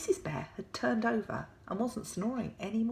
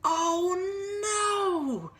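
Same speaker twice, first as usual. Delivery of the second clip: high, loud wail of dismay; Mister Bear despairing; 12 semitones higher, 5 dB louder than usual. Acted. Mister Bear kept awake by snoring, high loud despair. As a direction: moan it out, fed up. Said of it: "said Mister Bear".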